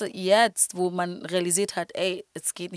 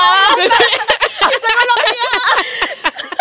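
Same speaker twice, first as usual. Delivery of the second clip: high, loud burst of laughter; loud shrieking laugh; 12 dB louder than usual. Real, not acted. Big laugh at own joke.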